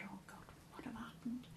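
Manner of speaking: whispered, retold inner thought; very soft, voiceless aside; hushed dread. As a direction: whisper it to yourself in alarm. Retold dread thought after a rumble.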